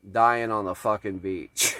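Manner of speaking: ordinary talk, nothing marked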